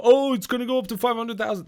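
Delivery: high, breezy salesman voice; a billionaire hyping his coin to investors, high and breezy; mock billionaire hype. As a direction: sell it like a smug crypto billionaire. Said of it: "people and go".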